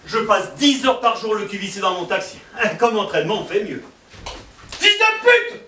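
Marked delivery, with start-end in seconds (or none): 4.8-5.6 sudden high shouted insult; tic outburst. Acted. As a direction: yelp the insult out involuntarily.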